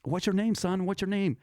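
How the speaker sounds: high, urgent questioning at normal volume; imitated concerned bystander. Lady who runs up to the bleeding kid, imitated concern. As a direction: voice the woman taking charge, pitched high. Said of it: "and she goes".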